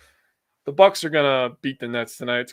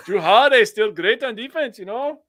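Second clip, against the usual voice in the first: high, louder, laughing put-on Canadian voice; mock Canadian accent; 10 semitones higher, 5 dB louder than usual. Cracking up while mocking the accent, laughing mock Canadian accent. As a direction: laugh through a Canadian impression. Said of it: "That was annoying".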